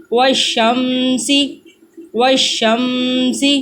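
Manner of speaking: و شمسی read slowly, the م doubled; slow teaching read with shadda; doubled consonant. Shown as a demonstration. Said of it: "تشدید ... دوبارہ سے پڑھتے ہیں".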